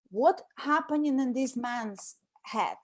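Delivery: ordinary talk, nothing marked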